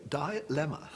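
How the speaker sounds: "Dilemma" said with a long die-, DIE-lemma; posh dilemma. Shown as a demonstration. Speaker showing upper class pronunciation, "Dilemma" as die-lemma. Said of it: "That's how we say it".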